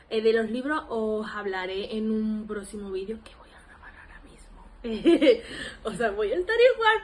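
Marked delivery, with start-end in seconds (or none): 3.2-4.6 breathy giggly whisper, half laughing; whispered laughing aside. Real, not acted. 4.7-5.8 bright burst of giggling; loud giggle. Real, not acted. Laughs at herself. 5.8-7.0 laughing high. Real, not acted. Laughing, much higher.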